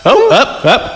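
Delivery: ordinary delivery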